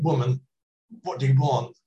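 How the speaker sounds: mildly confrontational demand; argumentative. Shown as a demonstration. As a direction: say it as if starting an argument. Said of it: "might say in an argument".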